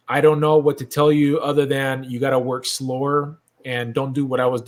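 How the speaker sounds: usual speaking voice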